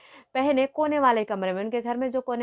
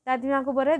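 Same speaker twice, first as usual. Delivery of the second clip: usual speaking voice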